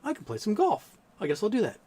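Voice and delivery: high, casual retold agreement. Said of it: "I'm like".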